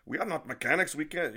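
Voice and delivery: retold angry island man; indignant protest. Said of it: "I can't do his island accent".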